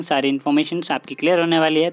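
usual speaking voice

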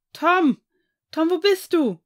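loud, high, searching shouts; calling into darkness. A narrator's reading. Ian searching for Tom at the cliff edge, loud desperate call. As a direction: call out far for a lost friend. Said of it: "rief er in die Dunkelheit".

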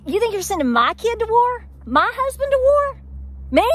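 high, loud indignant put-on voice; mock outrage. Vlogger mocking a draft announcement, high, loud indignant voice. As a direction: play the scandalised citizen, pitched well up. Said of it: "when I go".

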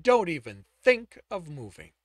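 loud threatening warning; stern warning. A narrator's reading. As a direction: warn him off, hard. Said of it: "his father warned".

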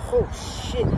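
breathy strained curse mid rep; strained gasp. Real, not acted. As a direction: push it out under strain. Grunt mid set.

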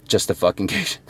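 a quiet, inward echo of Justification; hushed repeat. Real, not acted. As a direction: drop to a near-whisper on Justification. Repeating the key word for emphasis.

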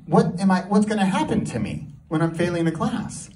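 normal talking voice

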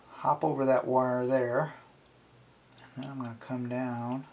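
ordinary delivery

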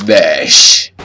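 loud, low burst on one word; shouted count. Real, not acted. Player counting off a hit, loud shout. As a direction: punch it out in a shout.

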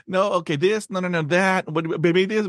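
high, hurried inner voice; frantic rehearsing. Retold or imitated. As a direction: rattle through options in a panic. Mimicking his own anxious rehearsing.

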